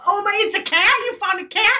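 light up as it finally clicks; sudden realization. Retold or imitated. Mom finally understanding the toddler, high and delighted. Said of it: "And then".

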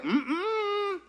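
high, soft mm-mm with a laugh; high disapproving hum. Retold or imitated. God turning the preacher down with a high hum. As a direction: hum a playful no.

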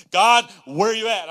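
loud, high anguished shout; yelling at God. Retold or imitated. Pastor retelling his prayer on the drive, yelling. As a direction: cry out to heaven at full voice. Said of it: "I'm, like, yelling at God".

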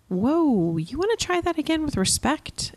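high, light and teasing; playful. Shown as a demonstration. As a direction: tease the kid into trying again. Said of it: "It could be a little more playful".

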